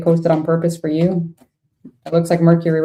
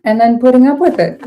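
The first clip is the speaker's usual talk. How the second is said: louder, higher burst of exasperation; venting frustration at people who tolerate disrespect; frustrated; 6 semitones higher, 5 dB louder than usual. Real, not acted. Reader venting to viewer about disrespect, frustrated and raised. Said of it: "I get so frustrated".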